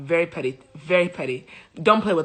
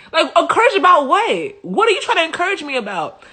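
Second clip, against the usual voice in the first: incredulous; 9 semitones higher, 4 dB louder than usual. Retold or imitated. Incredulous reply voiced for woman.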